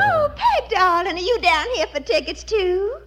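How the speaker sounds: high, sugary gushing greeting. Acted. Rival greeting the wife in the ticket line, gushing.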